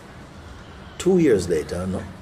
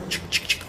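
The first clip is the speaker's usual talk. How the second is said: digging noises; 7 dB quieter than usual. Retold or imitated.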